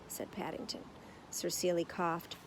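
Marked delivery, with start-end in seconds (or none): none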